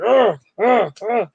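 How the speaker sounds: three put-upon groans; frustrated groans. Retold or imitated. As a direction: groan like a sulky kid. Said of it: "just like".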